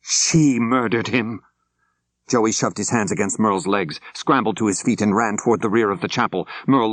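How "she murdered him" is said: said loudly. A narrator's reading. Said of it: "as she said loudly".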